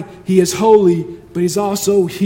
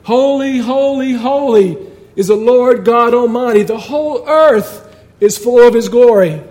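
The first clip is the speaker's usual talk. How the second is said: raised, ringing call to each other; shouting; 4 semitones higher, 5 dB louder than usual. Acted. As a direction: cry it out high and loud, like an antiphonal shout. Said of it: "They were shouting to each other".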